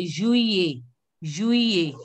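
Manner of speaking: juillet said through to the final "ay"; juillet, every vowel sounded to the end; French juillet. Shown as a demonstration. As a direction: model juillet as "zhwee-ay", falling. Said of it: "you have to pronounce till here".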